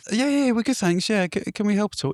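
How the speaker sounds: high nervous yeah yeah; nervous self. Retold or imitated. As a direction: squeak out a guilty reply. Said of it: "I said".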